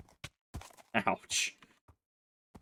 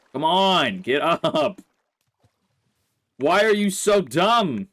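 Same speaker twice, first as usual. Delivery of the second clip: louder, higher, drawn-out exasperation; frustrated yelling; 6 semitones higher, 5 dB louder, slower than usual. Real, not acted. Player berating the horse that won't jump, frustrated yell. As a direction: shout at the animal in disbelief.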